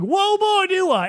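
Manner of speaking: high loud eager whoa; hammed-up eager yes; overeager exclamation. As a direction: jump at the question.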